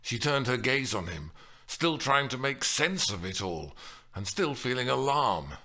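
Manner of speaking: usual speaking voice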